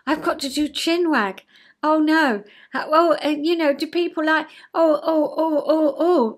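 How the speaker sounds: high, loud, fretful oh-oh panic; panicking child. Retold or imitated. Panicking inner child before chin wag, high loud fretting. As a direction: flap and fret like a frightened child. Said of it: "I was in child quite a lot".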